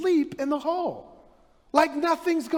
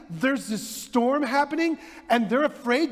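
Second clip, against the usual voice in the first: normal talking voice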